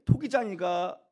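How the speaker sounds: ordinary talk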